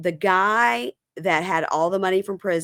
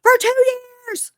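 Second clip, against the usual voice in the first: very high, loud mock shriek; exasperated outburst; 5 dB louder than usual. Woman told to have patience, retold as shrieking exasperation. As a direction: imitate someone at the end of their patience, pitched way up.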